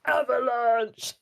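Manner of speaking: slow drawn-out yell; shouting fan. Retold or imitated. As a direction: bellow the team name across the street. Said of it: "just going".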